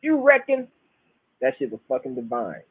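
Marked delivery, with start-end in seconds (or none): 0.0-0.7 Basil Riley. Retold or imitated. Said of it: "How he just hit that shit".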